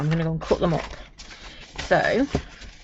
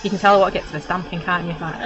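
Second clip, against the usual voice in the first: ordinary delivery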